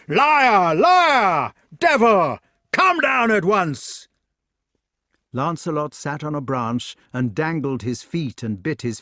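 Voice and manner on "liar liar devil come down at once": high, very loud furious outburst; purple-faced shouting. A narrator's reading. Fat knight to the man up the tree, shouting in rage. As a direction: shout as if about to burst with rage. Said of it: "grew quite purple in the face and shouted".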